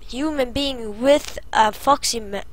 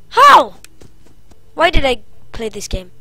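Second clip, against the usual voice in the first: panicked; 2 semitones higher, 6 dB louder than usual. Real, not acted. Stuck at the door, yelping in panic. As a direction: squeak it out in alarm. Panicking during horror game.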